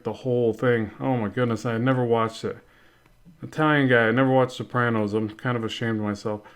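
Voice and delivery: ordinary talk, nothing marked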